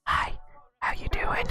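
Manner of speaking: breathy close whisper greeting. Shown as a demonstration. GM demos an intimate mic voice, whispering. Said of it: "a little quieter and a little more int".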